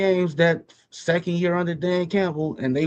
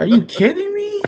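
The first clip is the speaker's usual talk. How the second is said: high, loud, are you kidding me disbelief; incredulous outburst; 14 semitones higher, 5 dB louder than usual. Real, not acted. Fed-up Bears fan scoffing at critics, loud and high. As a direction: shoot up in shocked disbelief.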